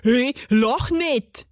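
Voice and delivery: put-on offended grumble; hissing gnome. A narrator's reading. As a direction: snap at her in gnomish dialect. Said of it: "zischte der Zwerg".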